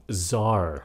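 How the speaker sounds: CZAR as "zar"; silent C. Shown as a demonstration. Teacher repeats for learners, CZAR with silent C. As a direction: say CZAR with no C, just Z. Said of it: "Âm C nó câm".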